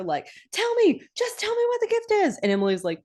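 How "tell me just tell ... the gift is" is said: very high-pitched mock nagging; squeaky, hammed-up begging; pestering. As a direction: pester them like an insistent child. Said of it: "following her around and, like, sneaking up on her".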